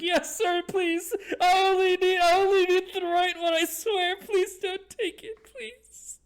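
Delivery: very high, loud wailing plea, fading on the last please; terrified begging. Acted. Intimidated prisoner begging to keep his hand, shrill tearful pleading. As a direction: wail and beg in a panicked falsetto.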